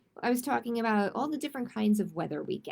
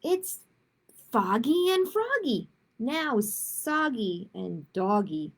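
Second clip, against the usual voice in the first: bouncy, louder picture-book reading; lively rhyming read-aloud; 3 dB louder, slower than usual. A narrator's reading. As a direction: read each rhyme like a game for kids.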